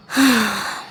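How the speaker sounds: a soft groggy sigh; weary sigh. Acted. Still groggy girl steadying herself, soft sigh. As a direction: breathe out to collect yourself.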